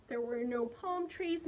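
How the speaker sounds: ordinary delivery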